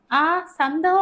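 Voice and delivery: high, loud cry of delighted surprise; excited exclamation. A narrator's reading. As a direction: burst out on suddenly spotting it. Sudden cry on the find.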